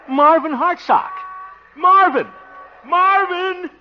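high, loud calls; calling out. Acted. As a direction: shout his name eagerly. Calling to school friend.

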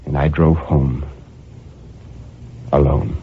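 usual speaking voice

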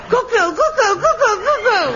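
high, piercing mock cuckoo; mocking cuckoo call. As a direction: mimic the bird to show how maddening it is. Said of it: "that stupid stuffed bird pops out".

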